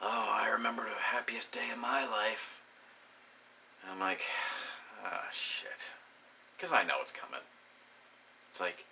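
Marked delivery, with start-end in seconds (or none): 0.0-2.6 soft whispery mock-sentimental sigh; dreamy reminiscer. Someone recalling their happiest day, whispery mock-sentimental. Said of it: "someone else will be like". 5.0-5.9 very soft whispered groan; muttered dread. Acted. Listener who knows what story is coming, whispered dread.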